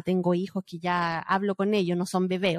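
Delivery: usual speaking voice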